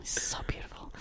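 hushed, adoring aside; very soft, breathy-quiet admiration; swooning whisper. Real, not acted. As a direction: whisper it to yourself, smitten. Swooning aside about the actor.

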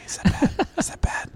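whispered guilty question; sheepish whisper. Real, not acted. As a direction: hush it like a confession.

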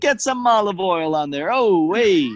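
high, singsong mock-Italian urging; Italian accent. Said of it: "we're doing an Italian accent".

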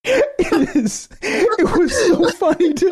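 high giggly voice; laughing. Real, not acted. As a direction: still laughing as you speak. Cracking up over the video.

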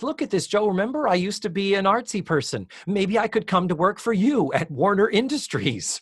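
put-on high, eager girlish plea; a hint of a bright young woman begging for a job; Blair's plea. Blair quoted pleading to Joe.

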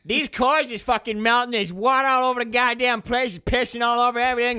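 high, loud angry yelling; loud rough shout. Acted. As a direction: bark it out over the noise. Acted crew member shouting complaints.

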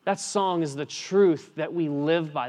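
ordinary talk

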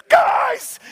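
high, loud burst of a cry; sudden high yell; excited shout. Retold or imitated. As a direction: call out like an angel bursting in. Angel bursts through curtain.

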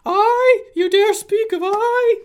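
high, loud wailing ghost; ghostly voice. Acted. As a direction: wail it like a mocking spirit. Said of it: "the ghostly voice of Ingester".

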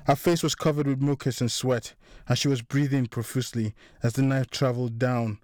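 ordinary delivery, nothing marked

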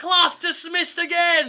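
high, drawn-out announcement; mock excitement. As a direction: send them off high and slow.

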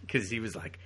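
usual speaking voice

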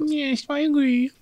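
put-on high cutesy baby talk. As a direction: squeak it like a toddler. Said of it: "why do you keep doing this baby voice".

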